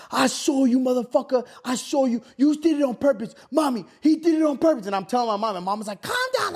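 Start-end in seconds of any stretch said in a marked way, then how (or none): 0.1-4.9 retold losing it. Son tattling to mommy on stepdad, losing it. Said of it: "I lose it". 6.0-6.5 imitated mom, pitched way up; high squeal of a mom intervening; mock exasperated mom. Mom breaking up son and stepdad, mock exasperation.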